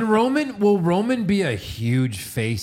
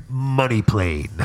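slow, drawn-out movie-title voice; lower, stretched-out mock announcement; announced title; 4 semitones lower, much slower than usual. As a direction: intone it slowly like a trailer. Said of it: "Then you just hear".

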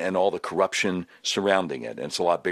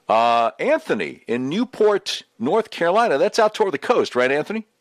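radio host announcer; 3 semitones higher, 3 dB louder than usual. Acted. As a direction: announce the number and caller like a DJ. Host opens phone lines again.

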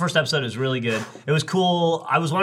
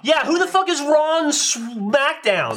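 mock dumb question; 9 semitones higher, slower than usual. Mocking a wrestling mix-up.

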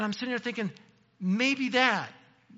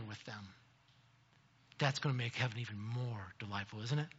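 much softer, lower reflective preaching; hushed sermon; 6 semitones lower, 11 dB quieter than usual. Real, not acted. Preacher softly telling the congregation about loved ones in heaven, hushed. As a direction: lean in and speak it gently.

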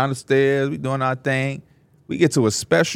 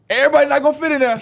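stylist's announcement; 13 semitones higher, 4 dB louder, much faster than usual. Retold or imitated. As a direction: call it out high as the stylist. Retelling stylist's loud announcement.

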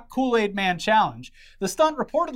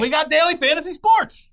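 excited; 9 semitones higher, 5 dB louder than usual. Pretend. Hyped ad voice.

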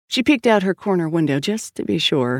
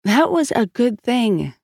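slow, with "was" lifted high and drawn out; stress on WAS; 6 semitones higher, slower than usual. A narrator's reading. Sister thinking of a relationship now over, heavy stress on "was". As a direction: lean pointedly on the word "was". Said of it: "Emphasis on the was".

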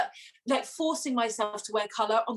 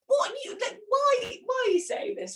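high, incredulous retort to a rude comment; mock indignant reply; 13 semitones higher than usual. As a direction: play it outraged but jokey, pitched up. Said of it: "read out the comment and be like".

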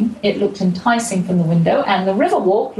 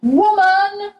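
much higher mock yell of an old man; imitated shout; 13 semitones higher than usual. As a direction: bark it out like a rude old man. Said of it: "by an old man who shouted".

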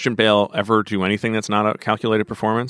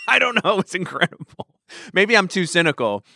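laughing; 8 semitones higher than usual. Real, not acted. Laughing at Bale's speech.

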